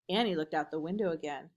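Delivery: plain reading voice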